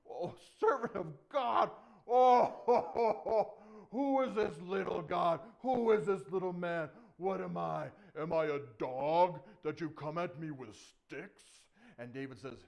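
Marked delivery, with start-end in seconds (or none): 0.1-11.4 booming scorn with a big ho-ho laugh; mocking Goliath. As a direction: bellow your contempt like a giant. Goliath mocks David.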